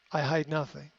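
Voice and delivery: ordinary delivery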